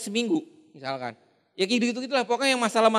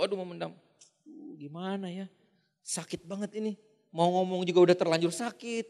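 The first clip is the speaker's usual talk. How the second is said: much softer, hurt and holding it in; quiet hurt; 7 dB quieter than usual. Retold or imitated. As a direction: mutter the pain to yourself. (lirih menahan sakit hati)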